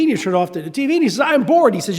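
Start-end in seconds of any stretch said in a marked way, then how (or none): 1.2-1.7 higher, louder bored whine; whiny kid. Retold or imitated. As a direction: Calvin complaining to his dad. Calvin whining to dad.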